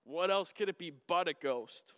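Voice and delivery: normal talking voice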